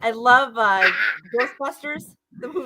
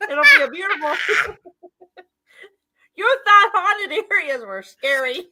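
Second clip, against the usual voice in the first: ordinary talk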